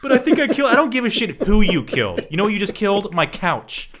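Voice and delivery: loud, high ranting through laughter; mock furious shout. Cohost playing an outraged parent over the couch, loud laughing rant.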